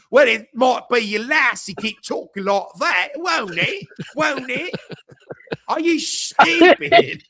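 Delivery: high, loud hammed-up Cockney; mock-menacing Cockney, stretched out at the end; bad Cockney accent. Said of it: "Cockney accent and challenge".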